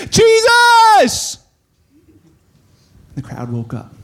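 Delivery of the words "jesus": high, loud, drawn-out cry; full shout. Acted. Blind beggar calls to Jesus passing by, shouting. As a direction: holler over a crowd. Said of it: "he began to shout".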